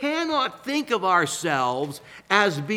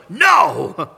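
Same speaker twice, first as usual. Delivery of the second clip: a sudden loud one-word no; curt emphatic shout; 8 dB louder than usual. Retold or imitated. John cutting off the questioners with a loud no. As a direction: bark the final no as a shout. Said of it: "And then the last one".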